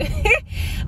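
very high, loud squeal of a laugh; squealing little laugh; high giggle. Real, not acted. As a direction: giggle at your own excitement. Laughs at herself on camera.

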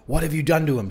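a contained screech of accusation. A narrator's reading. Frantic mother accusing her son's friend, restrained screech. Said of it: "she screeched".

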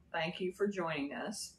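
normal talking voice

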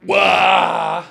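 loud startled yelp; a burst of noise out of nowhere; sudden shout. Acted. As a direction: bark out a sudden wah. Sudden shout mid-story.

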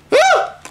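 sudden high shriek; startled scream. Real, not acted. Gamer hit by a jump scare, shrieking. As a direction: jump out of your skin.

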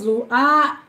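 ordinary delivery

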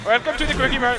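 Apu impression. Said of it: "I go to Apu".

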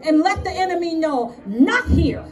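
full-force preacher, punchy repeated phrases; fervent preaching. Real, not acted. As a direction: preach it like a battle cry.

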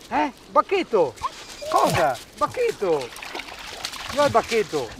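very high, eager urging; coaxing the dog to fetch; talking to a dog. Real, not acted. (incitando il cane, voce acutissima)

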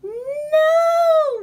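high loud despairing wail. A narrator's reading. Squirrel wailing at the ruined picnic, anguished wail. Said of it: "wailed Squirrel".

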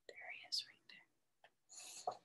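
conspiratorial whisper; hushed reveal. Acted. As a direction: point him out in a secret whisper. Finding Henry in leaves.

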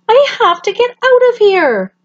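a little bird wanting to flee; scared baby bird. Acted.

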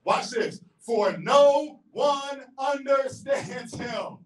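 ordinary delivery

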